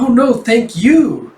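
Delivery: high, slow, drawn-out thanks; gracious gushing. Acted. Cafe owner answers the polite newcomer, gushing. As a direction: coo the thanks, loud and lilting.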